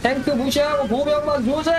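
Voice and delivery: high, forceful battle order; battle roar. Acted. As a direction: yell the order at the troops. Ordering troops in game.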